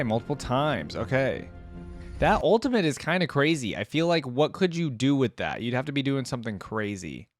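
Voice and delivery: ordinary talk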